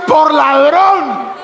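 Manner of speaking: high, drawn-out accusing retort; indignant retort. Acted. Pastor answering the thief he hit, indignant retort. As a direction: throw the accusation back high and slow.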